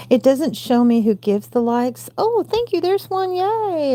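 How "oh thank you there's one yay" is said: much higher, bubbly thanks; delighted squeal. Real, not acted. A new like arrives live.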